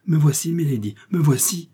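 high, hurried-to-answer call; eager cry. A narrator's reading. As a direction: call out as you rush to your mistress. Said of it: "s'écria Ketty en s'élançant".

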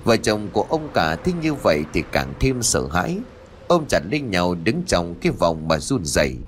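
plain reading voice

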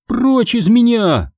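high, drawn-out order; high-pitched imperious command. A narrator's reading. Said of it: "приказала она".

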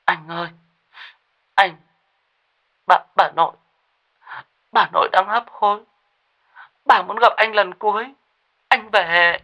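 high, choked voice about to cry; choked, near tears. Acted. Wife Lê phoning Khôi about dying grandmother, choked and tearful. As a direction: plead through a lump in the throat, pitched high. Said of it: "giọng nghẹn nghẹn như sắp khóc".

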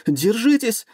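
high, loud panicked cry. Acted. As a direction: scream a warning in panic. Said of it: "его место заняла паника".

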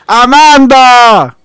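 long drawn-out shout of a name; calling out. Real, not acted. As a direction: call loudly across the house. Calling out to someone offstage.

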